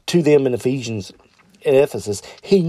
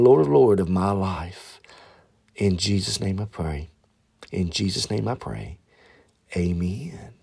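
prayer; 4 semitones lower than usual. Acted. Pastor leading podcast listeners in the sinner's prayer, low and hushed.

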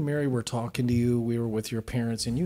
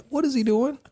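alarmed; 10 semitones higher than usual. Retold or imitated. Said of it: "I'm like".

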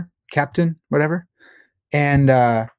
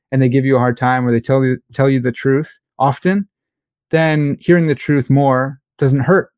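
ordinary delivery

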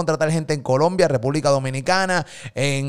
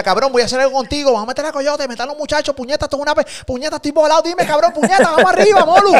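rising higher and louder, fired up; hyped-up friend; 10 semitones higher, 4 dB louder, faster than usual. Retold or imitated. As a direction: imitate a buddy bursting with hype. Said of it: "Joel me llamó estos días".

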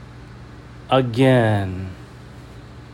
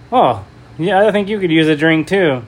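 high bright taunting voice; higher and louder, falsely cheerful; mock cheerful taunt; 6 semitones higher, 5 dB louder than usual. Jenny taunts the scared man.

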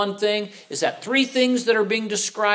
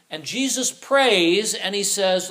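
ordinary talk, nothing marked

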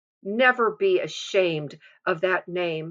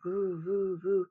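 fan noise; 9 dB quieter than usual. Retold or imitated. Imitating the bedroom fan at night with a soft whoosh. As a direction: hum it like a spinning ceiling fan. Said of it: "the fan was making a classic fan noise".

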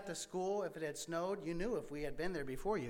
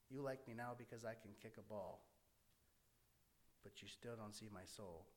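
much softer, wounded reply kept to himself; hurt inner thought; 8 semitones lower, 15 dB quieter than usual. Retold or imitated. Inner answer to classmates who only like him for soccer, soft and hurt. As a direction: say it under your breath, still stung.

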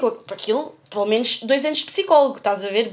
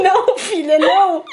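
louder, laughing mock scold; grandma scolding; 4 semitones higher, 6 dB louder, slower than usual. Retelling grandma forbidding more calls, laughing scold. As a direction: cut her off firmly, giggling. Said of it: "E ela".

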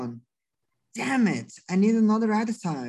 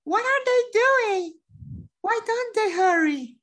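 put-on high, frightened young girl; anxious child asking, pitched up; scared girl; 11 semitones higher than usual. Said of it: "Kelly is getting scared".

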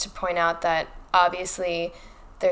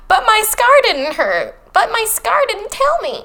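high, loud mock whining; hammed-up shrieky protest; whiny Harry; 7 dB louder than usual. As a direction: protest like a wailing, overdramatic teenager. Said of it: "And Harry's all like".

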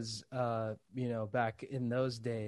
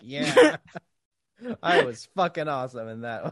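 mock boasting; 7 dB louder than usual. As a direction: crow about your own take. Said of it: "actors mark out to themselves".

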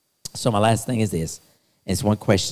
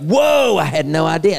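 high, loud startled whoa; a big, loud wide-eyed discovery; loud acted surprise at something huge; amazed gasp; 5 semitones higher, 4 dB louder than usual. Imagined person turning, seeing God's plan.

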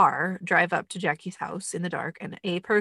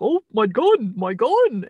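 mock bumbling Mister Magoo; 5 semitones higher, 8 dB louder, slower than usual. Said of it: "He's like".

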